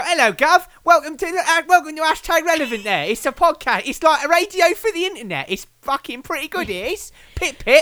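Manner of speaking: put-on high, loud caricature Cockney accent; hammed-up Cockney. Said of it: "Cockney on today".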